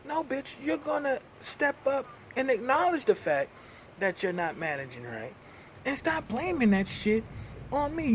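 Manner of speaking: heated callout of the boss; angry rant. Real, not acted. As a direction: tell him off hard. Ranting at supervisor.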